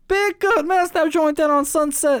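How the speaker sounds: mock Jules. To the burger guys, hammed-up excited Jules. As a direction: oversell the burger joint. Jules voiced in retold scene.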